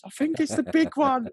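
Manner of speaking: high-pitched mock girlish yell. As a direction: squeal it like an excited girl. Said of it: "like, in a girlish voice".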